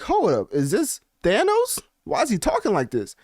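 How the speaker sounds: high, incredulous double take; mock disbelief. Mocking a rapper's statement, incredulous high disbelief. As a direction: react like you just heard a supervillain. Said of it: "This man talking like he about".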